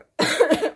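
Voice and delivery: short dry cough. Real, not acted. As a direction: cough mid-sentence. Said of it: "je tousse encore".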